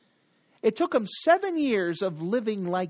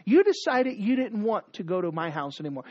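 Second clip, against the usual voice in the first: normal talking voice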